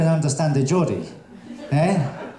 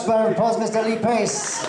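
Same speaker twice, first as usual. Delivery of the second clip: calling out; 7 semitones higher than usual. Real, not acted. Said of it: "calling for applause".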